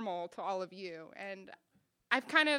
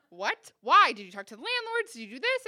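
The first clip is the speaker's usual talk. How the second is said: rapid string of higher-pitched demands; alarmed interrogation; 4 semitones higher, 9 dB louder than usual. Retold or imitated. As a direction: fire questions in mock disbelief. Scolding boyfriend over puppy.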